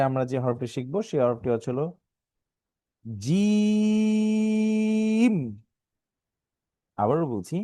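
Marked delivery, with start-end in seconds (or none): 3.0-5.3 জিম held very long on a steady, higher note; long stretch. Shown as a demonstration. Teacher models the letter's name for pupils, long-drawn জিম. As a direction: draw out the ee of জিম before closing on m. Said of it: "একটু বেশি টান".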